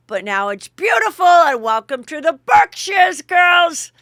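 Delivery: loud, high mock hostess shout; hostess welcome. Dorinda welcoming the girls to the Berkshires, loud mock hostess call. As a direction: belt out the welcome like a proud hostess.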